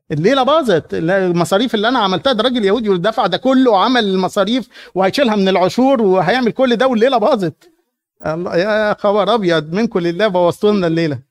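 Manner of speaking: a wronged host ranting; indignant host. Acted. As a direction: fume that the evening is ruined. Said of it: "بيقول إيه؟".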